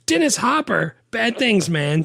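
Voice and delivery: high and loud, fizzing with glee; thrilled exclamation. Retold or imitated. Retelling his notes to his co-host, he gushes loudly. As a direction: gush it like five exclamation points. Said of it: "five exclamation points".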